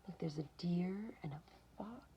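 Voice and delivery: hushed whisper, pointing something out; soft secret whisper. A narrator's reading. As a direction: whisper so you don't scare them. Whispered aside to listener.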